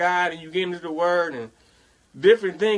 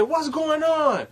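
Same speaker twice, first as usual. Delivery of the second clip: mock flustered coworker; panicky coworker; 9 semitones higher than usual. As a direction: pitch up into her panic. Coworker voiced again, panicking.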